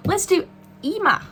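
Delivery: Emma in a badly faked Australian accent, vowels pushed. Shown as a demonstration. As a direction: say Emma the way a mock Australian would, Emma said with a jokey vowel. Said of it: "I have to say it like that with a really bad Australian accent".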